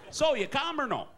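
put-on high, loud Wisconsin accent. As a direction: ask it in a hammed-up Wisconsin accent. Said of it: "with a Wisconsin accent".

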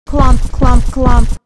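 loud, punched, evenly spaced stomps; heavy clomping. Acted. Scary movie footsteps for young readers, loud clomping. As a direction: land each one like a heavy footstep.

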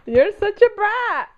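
play-scold her like a spoiled pet; mock scolding. Real, not acted.

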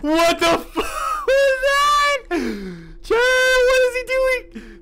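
very high, loud, stretched shriek with laughter; laughing scream. Real, not acted. Streamer startled by Shrek turning his head, screaming and laughing. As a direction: scream as the scare hits.

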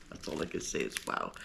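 breathless stunned whisper, quick; awed whisper. Real, not acted. Opening a surprise bag from Pam, awed whisper. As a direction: barely get the words out, whispering in awe.

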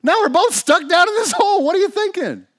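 high, loud, exasperated outburst; upset yelling. Acted. Bob berates buddy who jumped in too, upset yelling. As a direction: scold a friend at a shout in disbelief. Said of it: "But Bob's pretty upset".

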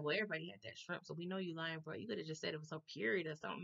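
soft, fast, confidential murmur; hushed aside. Retold or imitated. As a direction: pull him aside and say it softly and quickly. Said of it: "He was like".

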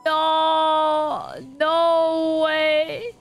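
normal talking voice

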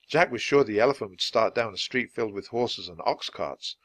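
ordinary delivery

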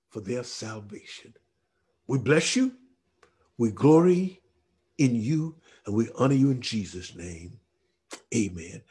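low, measured prayer with long pauses; solemn closing prayer. Acted. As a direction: pray it gently down to the amen. Closes the prayer.

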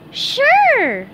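very high, loud cartoon assent; SpongeBob agreeing. A narrator's reading. SpongeBob agrees to share eggs with Patrick, cheerful. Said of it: "said SpongeBob".

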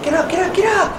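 high loud urging yell; desperate high cry; frantic shout. Real, not acted. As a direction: yell at the game to climb. Urging character upward.

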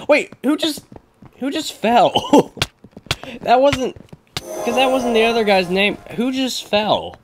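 high-pitched laughing disbelief; amused bewilderment. Real, not acted. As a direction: squeak out the question in baffled amusement. Laughing confusion over who fell.

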